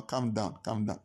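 low, soothing reassurance; calming. Retold or imitated. Retells soothing his upset father.

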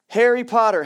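loud, sudden topic jump; loud callout. Acted. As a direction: burst out with the name, loud.

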